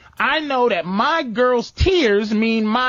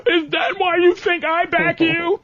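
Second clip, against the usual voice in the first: mock hurt girlfriend; 8 semitones higher than usual.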